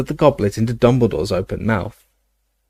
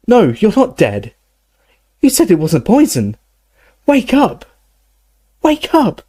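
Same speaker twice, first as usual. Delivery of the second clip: high cries, climbing steeply; panicked pleading; 11 semitones higher, 4 dB louder than usual. Acted. Harry trying to rouse unconscious Dumbledore, high panicked cries. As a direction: cry out higher and higher in panic.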